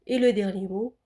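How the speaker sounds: ordinary delivery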